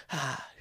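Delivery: imitated scream petering out into a whisper; scream fading to whisper. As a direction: let the imitated scream shrink to a breath. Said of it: "it kinda almost got to a whisper".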